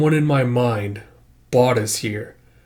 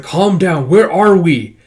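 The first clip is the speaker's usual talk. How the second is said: raised, higher voice cutting in; urgent demand; 5 semitones higher, 7 dB louder, slower than usual. Acted. Micah to panicking companion in a strange world, urgent demand. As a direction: press hard for an answer.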